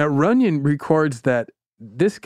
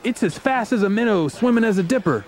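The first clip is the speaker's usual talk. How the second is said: higher-pitched, folksy Southern boast; pitched-up Southern bragging; bragging Southerner; 8 semitones higher than usual. Acted. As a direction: brag in colorful Southern style. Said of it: "he's, like, bragging to the Brain".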